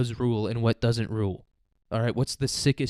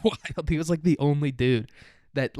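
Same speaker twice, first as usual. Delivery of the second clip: normal talking voice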